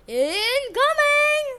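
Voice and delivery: high, loud, long-drawn holler; yelled warning. A narrator's reading. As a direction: holler a warning, stretching the end. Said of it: "yelled Poseidon".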